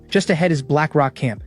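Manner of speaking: ordinary delivery, nothing marked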